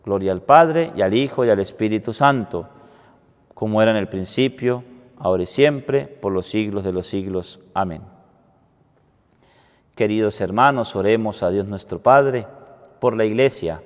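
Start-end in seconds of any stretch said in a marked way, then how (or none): none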